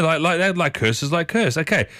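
ordinary talk, nothing marked

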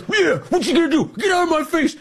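high, mocking voice squaring up to troopers; mocking activist taunt. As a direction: sneer a taunt like a brat in cops' faces.

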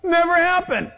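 high-pitched, scoffing devil; taunting Satan. Acted. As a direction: scoff like the devil crushing someone's hope. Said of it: "And he goes".

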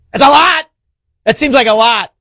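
incredulous outburst. Real, not acted. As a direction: shout it like you can't believe the sum. Host hears the fraud figure.

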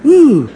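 high-pitched, cautious "ooh"; a high little ooh of treading carefully; wary "ooh". Retold or imitated. Eliphaz approaching Job like the wary lion, high ooh. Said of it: "He's kinda".

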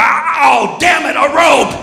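high, exasperated shark outburst; hammed-up cry of a thwarted man-eater; frustrated shark. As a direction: act out a foiled shark cursing. Acts shark foiled by rope.

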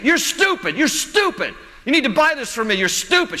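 high, insistent mock-bratty child; imitating yelling kid. Kid pestering mom for a toy, mock yelling. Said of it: "he just kept saying it".

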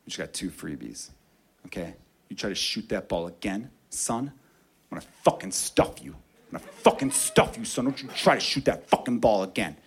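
very low, quiet, menacing warning; angry defender warning the kid not to shoot; menacing threat. Retold or imitated. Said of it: "He says".

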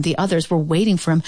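usual speaking voice

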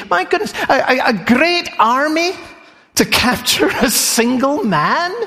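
high, incredulous "a great army?"; mock amazement. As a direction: sound astonished at the size of the army. Preacher voices amazed listener.

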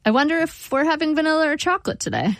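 cheerfully steer away; bright, diverting tone. A narrator's reading.

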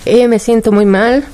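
telling the hardest part with emotion rising; near tears. Real, not acted. Mother recounting daughter's crisis.

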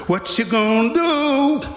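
high-pitched mock taunt; challenging. Veteran's look dares the rookie to act, challenging. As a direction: put the rookie on the spot.

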